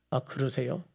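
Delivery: soft, low acted answer; hushed reply. Jesus replying to the Father, hushed.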